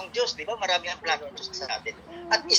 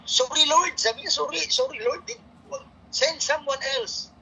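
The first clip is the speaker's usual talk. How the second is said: pleading Moses; 6 semitones higher than usual. Retold or imitated. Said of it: "Bulol-bulol ako magsalita".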